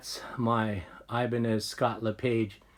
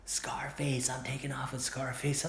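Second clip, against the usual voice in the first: whispering; 8 dB quieter than usual. Retold or imitated. Friend quietly telling him he's leaving.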